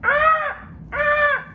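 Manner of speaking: very high parrot, gloating squawk at the end; cheeky parrot. Acted. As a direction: win the argument as the parrot.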